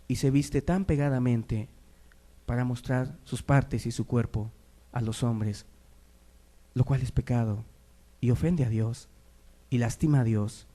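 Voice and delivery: normal talking voice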